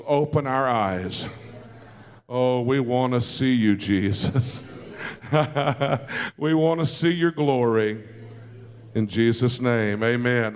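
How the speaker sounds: a worship leader's intimate closing prayer, low and unhurried. Acted. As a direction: pray it tenderly and reverently. Leads the congregation in prayer.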